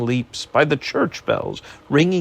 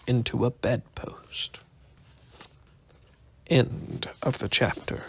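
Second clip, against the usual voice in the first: whisper; 7 dB quieter than usual. A narrator's reading. Spooky fade at chapter end.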